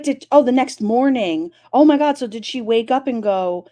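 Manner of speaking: ordinary talk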